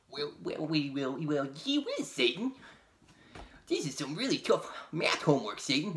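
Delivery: a hint of a kid doing homework; young boy voice. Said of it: "that's Leo".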